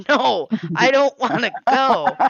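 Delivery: giggling, backing away from the offer; laughing refusal. Retold or imitated. Refusing the coworker's invitation, laughing loudly. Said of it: "And I'm like".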